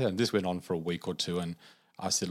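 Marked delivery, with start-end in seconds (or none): none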